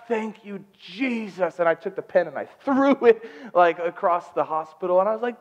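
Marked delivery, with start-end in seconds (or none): 0.1-1.6 high, softer cry of gratitude; relieved thanks. Retold or imitated. Husband hearing lungs arrived, relieved thanks. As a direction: thank heaven with released tension.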